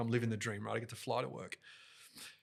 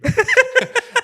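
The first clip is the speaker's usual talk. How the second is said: loud high burst of laughter; real crack-up; big laugh; 19 dB louder than usual. Real, not acted. Host laughing at his own mic mistake, big laugh.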